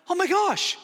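high, loud burst of shock; shocked gasp. Retold or imitated. Preacher reliving losing his sight, shocked cry. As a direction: blurt it out in sudden alarm.

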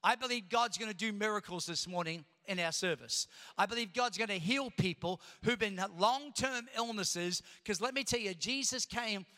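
fervent pastor in full flow; full preaching. Acted. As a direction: preach it out to the congregation.

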